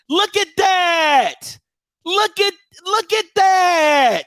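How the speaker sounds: high, drawn-out exclamation; exasperated disbelief. Real, not acted. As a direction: point it out in comic outrage. Mock outrage at what is shown.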